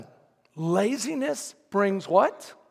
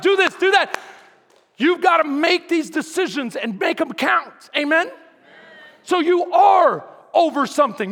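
loud fervent sermon; preaching at full force; 9 semitones higher, 9 dB louder than usual. Acted.

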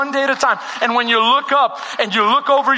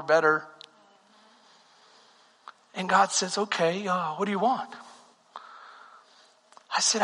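much softer, lower intimate storytelling; hushed testimony; 7 semitones lower, 8 dB quieter than usual. Acted. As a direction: draw them in close with a quiet confession.

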